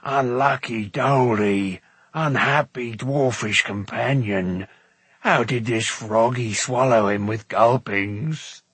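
slow, mournful creature voice; woeful slow moan; moaning lament. A narrator's reading. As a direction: bemoan a friend's awful fate, drawn out. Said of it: "moaned Gurgi".

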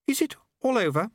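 very high, tentative character voice; tentative Unity. Acted. Unity asking Susan after the din stops, high and tentative. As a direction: ask timidly, pitched far up.

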